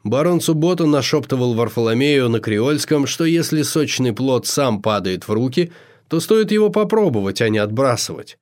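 ordinary delivery

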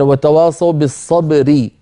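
"بالصبر" slowed, with a thick stopped r at the end; heavy r at the pause. Shown as a demonstration. Sheikh gives a second example of the heavy r. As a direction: recite "وتواصوا بالصبر" slowly, closing on a heavy r. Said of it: "مثلًا".

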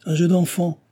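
high, sneering snicker in the voice. A narrator's reading. Engineer scoffing at Jean's worry, sneering. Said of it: "ricana l'ingénieur".

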